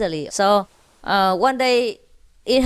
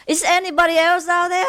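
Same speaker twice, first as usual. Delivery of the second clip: higher, faster laughing plea; desperate; 8 semitones higher, faster than usual. Acted. Hanging man asks for another rescuer, high fast laughing plea. Punchline, asking for another helper.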